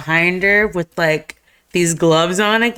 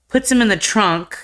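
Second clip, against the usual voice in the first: usual speaking voice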